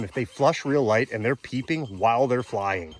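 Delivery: ordinary talk, nothing marked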